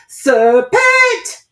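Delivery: a shrill, very high, loud bird scream; screaming pigeon. A narrator's reading. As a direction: shriek the word at her in alarm. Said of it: "screamed the pigeon".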